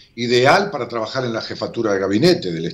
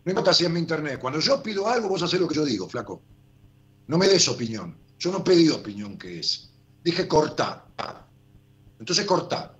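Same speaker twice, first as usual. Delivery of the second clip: irritated, raised-pitch telling-off; angry scolding; 5 semitones higher than usual. Real, not acted. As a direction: snap at the crew, fed up. Scolding production staff on air.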